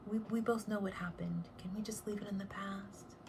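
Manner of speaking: hushed secretive whisper. Pretend. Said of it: "That is the tarot way of saying".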